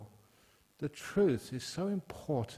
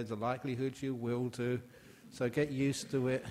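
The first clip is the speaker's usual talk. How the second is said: ordinary talk, nothing marked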